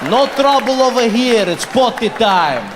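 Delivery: mock-tough Russian accent; hammed-up Russian accent for toddler talk. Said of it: "speak to myself in a Russian accent".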